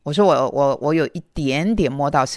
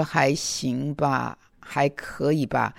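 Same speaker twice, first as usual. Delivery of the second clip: drawn-out, mildly dejected replies; slow, reluctant so-so answers; 垂头丧气; 2 semitones lower, 4 dB quieter, much slower than usual. Retold or imitated. Answering when the elder asks once more, downcast and slow. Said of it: "很垂头丧气的".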